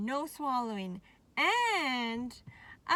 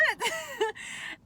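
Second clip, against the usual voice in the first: high laugh; 11 semitones higher, 4 dB louder than usual. Real, not acted. Laughing after retelling.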